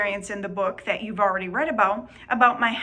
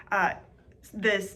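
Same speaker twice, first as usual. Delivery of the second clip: usual speaking voice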